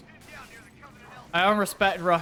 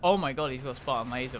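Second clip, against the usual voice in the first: ordinary talk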